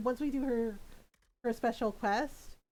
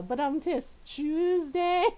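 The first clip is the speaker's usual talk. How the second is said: mock taunt; 8 semitones higher, 5 dB louder, slower than usual. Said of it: "It was like".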